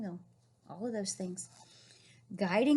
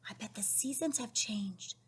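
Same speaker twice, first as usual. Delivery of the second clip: soft secret whisper; whispered aside; 5 dB quieter than usual. Acted. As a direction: lean in and whisper the guess.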